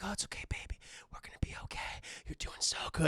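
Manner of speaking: hushed whisper, soothing; whispered comfort. Retold or imitated. Andre whispering to his strapped-down wife. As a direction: whisper to calm her down.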